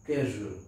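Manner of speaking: कैजुअल with a zh, low; zh sound. Shown as a demonstration. Teacher modelling कैजुअल, soft zh. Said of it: "इसे हम पढ़ेंगे कैजुअल".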